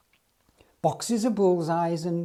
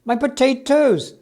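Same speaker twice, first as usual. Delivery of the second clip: higher, louder question; Mister Davis shouting at the driver; 8 semitones higher, 8 dB louder than usual. Acted. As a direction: call out for the potatoes. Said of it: "shouted Mister Davis".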